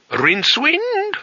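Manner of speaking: put-on very high, slow incredulous old man; old wizard voice. A narrator's reading. Old wizard doubting the name through the door, high and slow. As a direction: stretch the name out in aged disbelief.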